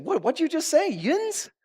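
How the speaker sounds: high-pitched mock disbelief; incredulous friends. High school friends hearing "yuns", high incredulous questioning. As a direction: squeal the question back, rising in bafflement. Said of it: "And they were like".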